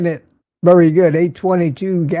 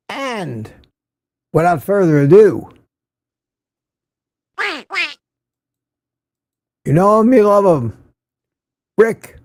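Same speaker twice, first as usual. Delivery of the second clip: booming game-show intro, pitched up; hammed-up announcer; 6 semitones higher than usual. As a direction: announce him like a star entrance. Announcing a regular chatter's arrival.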